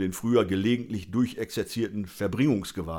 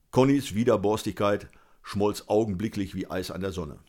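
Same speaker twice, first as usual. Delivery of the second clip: usual speaking voice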